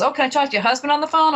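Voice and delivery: lightly acted, keen and hurried ask; eager request. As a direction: pitch it up and rush the retold request. Retells producer's eager request.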